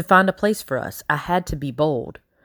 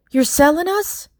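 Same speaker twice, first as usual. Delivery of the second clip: sharply higher, disbelieving question; shocked outburst; 11 semitones higher than usual. Acted. Girl to the master learning she'll be sold, shocked. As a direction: gasp it out before you can stop it. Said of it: "words flew out of my mouth".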